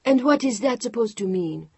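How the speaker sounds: glaring demand. Acted. Chantal glaring at Mark as he packs, angry demand. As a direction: throw the question at him, glaring. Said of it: "Chantal glared at Mark".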